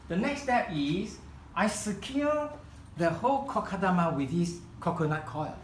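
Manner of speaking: normal talking voice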